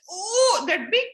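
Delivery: a delighted gasp of disbelief; amazed frog. Retold or imitated.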